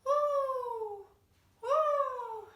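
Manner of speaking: playful, sustained owl call; owl hoot. Pretend. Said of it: "we're gonna be owls".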